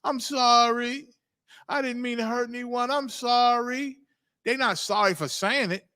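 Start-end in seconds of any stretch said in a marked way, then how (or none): 0.0-4.0 put-on pleading apology, pitched up; mock apology. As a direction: imitate an appeal for forgiveness, stretching the sorrys.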